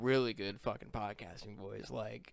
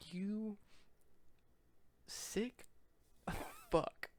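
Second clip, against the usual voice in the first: slow, halting mock condemnation; one word at a time, long pauses between; mock disgust; 8 semitones higher, 7 dB quieter, much slower than usual. Cohost reacts to a crude joke with drawn-out mock disgust.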